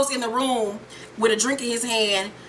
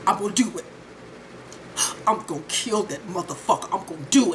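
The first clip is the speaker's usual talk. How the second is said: grim resolve; 4 semitones lower, 4 dB quieter, faster than usual. Retold or imitated.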